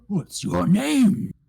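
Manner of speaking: high, slow creature voice; Jibber the right head. Pretend. Right head asks the adventurer's name, high and eager. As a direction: ask eagerly, drawing out the words. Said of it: "And Jibber goes".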